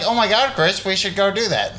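put-on high, amateurish girl voice; woman voice. Co-host on a second try at a woman's voice, high-pitched. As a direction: pitch it up like a badly faked woman. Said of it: "I don't have the best woman voice".

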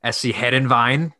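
"Hedenveen" said HED-en-vine, imitating the FIFA commentator; Derek Ray's pronunciation. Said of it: "Derek Ray pronounces as".

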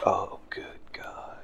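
slow unvoiced whisper; exasperated whisper. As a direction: breathe it out in despair.

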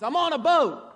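loud, high, emphatic rallying cry; confident shout. Shown as a demonstration. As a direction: belt it out with full confidence. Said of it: "Say it with some confidence".

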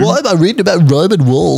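high, exaggerated whiny wondering; mocking John impression. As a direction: send up a puzzled friend. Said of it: "John saw the map ... referencing and went".

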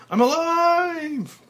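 very high, loud, slow cry of joy; joyful shout. Acted. Frank back safe, shouting with joy. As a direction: shout your relief to the world. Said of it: "finally shouting with joy".

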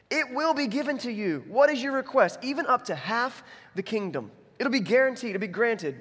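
high, emphatic king's proclamation; grand royal offer. Acted. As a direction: declare it high and grandly, like a king granting a wish. Preacher acts king's grand offer to Esther.

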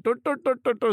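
high-pitched "dur dur" imitation; mocking nonsense babble. Director gushing over the accent he loves, high mock babble.

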